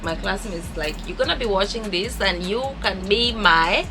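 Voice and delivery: ordinary talk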